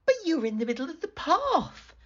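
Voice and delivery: put-on high, fast young girl protesting; girl protests to the wolf. A narrator's reading. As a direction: argue back quickly like a small girl.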